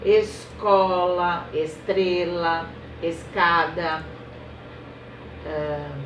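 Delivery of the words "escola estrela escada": each word read slowly, syllable by syllable; slow teacher reading of es words. Shown as a demonstration. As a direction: spell the words out slowly for children. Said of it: "que a gente já estudou".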